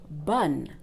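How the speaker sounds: bonne as one syllable ending on N, "bun"; silent final E. Shown as a demonstration. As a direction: say bonne with no vowel after the N. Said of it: "This word that you see".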